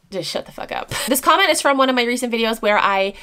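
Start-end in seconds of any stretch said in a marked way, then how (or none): 0.1-0.9 hushed, whispered put-down. Real, not acted. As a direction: whisper the insult. Telling off commenters.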